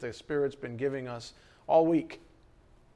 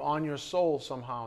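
normal talking voice

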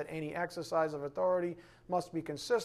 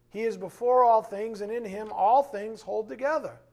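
scripture reading; 3 semitones higher, 4 dB louder than usual. Acted. Reading Colossians aloud.

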